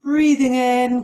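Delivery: breathing in, stretched long and loud; drawn-out breath cue. Shown as a demonstration. Yoga teacher cueing her class's inhale, long and loud.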